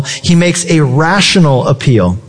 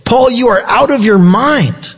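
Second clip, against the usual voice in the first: higher-pitched outburst, not truly shouted; a retold exclamation with raised pitch; mock loud voice; 6 semitones higher than usual. Roman governor interrupting Paul, mock raised voice. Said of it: "says in a loud voice".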